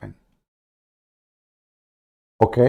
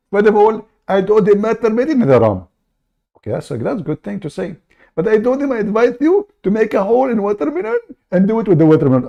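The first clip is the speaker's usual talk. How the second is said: raised, pushy put-on caller voice; fake caller voice; 8 semitones higher than usual. Prank caller cutting into the host's show, high put-on voice. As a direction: barge in as an eager fake caller.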